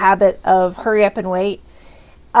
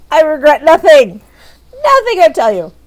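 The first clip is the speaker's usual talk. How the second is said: mock defiance; 5 semitones higher, 5 dB louder than usual. Mock villainous declaration.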